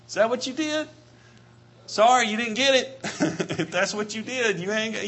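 chuckling through a teasing question; laughing. Real, not acted. Preacher teasing the congregation, laughing. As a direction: tease them with a laugh.

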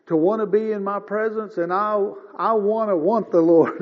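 ordinary delivery

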